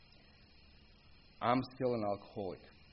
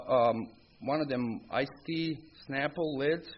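ordinary talk

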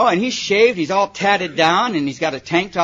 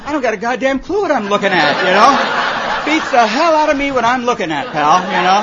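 higher, louder, laughing comeback; laughing retort; 4 semitones higher, 5 dB louder than usual. Retold or imitated. Comedian laughs his answer back at a pierced teenager. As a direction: laugh it back at the tough kid. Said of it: "I said".

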